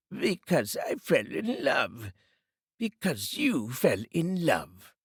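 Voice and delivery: slow, mocking repeat; growled mockery. A narrator's reading. Scrooge mocking his nephew's reason, slow and sneering. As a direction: sneer the words back at him slowly. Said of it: "growled Scrooge".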